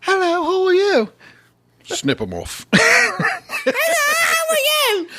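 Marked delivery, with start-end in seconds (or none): 0.0-1.1 put-on high, sweet little boy voice; sing-song choirboy greeting; a lightly voiced choirboy. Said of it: "Let me hear his voice". 2.7-5.1 very high falsetto with laughter; laughing falsetto squeak; squeaky falsetto. Acted. Castrated boy, laughing falsetto.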